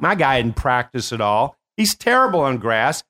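normal talking voice

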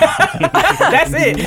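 high, laughing tease. Real, not acted. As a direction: laugh through the words. Laughing while teasing.

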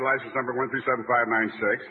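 usual speaking voice

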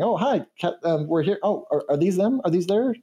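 high, bright chipper lab assistant; excited chirpy scientist; chipper canary. Acted. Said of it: "comes out looking chipper".

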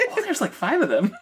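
high, laughing delight; giggly squeal. Real, not acted. Joe giggles high over five baby swans. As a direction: giggle at the count of cygnets. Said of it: "Joe squeals about pictures".

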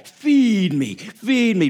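feed me, pleading and greedy; hungry grudge voice. Acted. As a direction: beg like a hungry little monster. The offense demanding to be fed.

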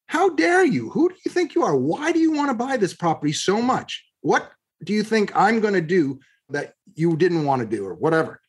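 high-pitched, indignant retelling of a plea; retold indignant pleading. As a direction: replay the outrage of being sniped out of a deal. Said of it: "I literally pleaded with him and said".

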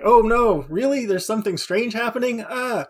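high-pitched mock disbelief; feigned surprise. Player pretending her character never saw weird things, feigned surprise. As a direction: play up fake shock, pitched well up. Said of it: "no longer have to pretend".

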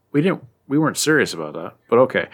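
ordinary talk

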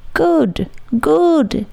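high, gleeful cry; a big drunk man crowing with joy. A narrator's reading. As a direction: crow it out in delight. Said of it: "he cried in high glee".

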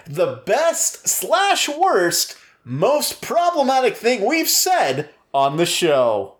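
slow, high, grand announcer read. Shown as a demonstration. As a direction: announce it like a game show host. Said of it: "give it a clean intro".

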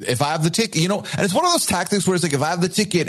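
ordinary talk, nothing marked

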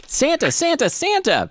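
high-pitched excited elf cry; Percy Giggly Legs crying out. A narrator's reading. Elf runs down the hall to Santa, crying out. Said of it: "Percy Giggly Legs cried out".

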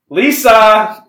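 high, loud shrill call of the name; shrill mom call. Retold or imitated. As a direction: call out like a screechy horror mom. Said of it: "in this very shrill sort of a way".

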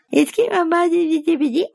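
put-on high, whining little kid; whiny child voice. Small child whining to mom, high mock whiny voice. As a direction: whimper it like a child begging mom. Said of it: "con voz llorona".

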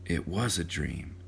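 soft, subdued reply; quiet. A narrator's reading. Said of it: "said John quietly".

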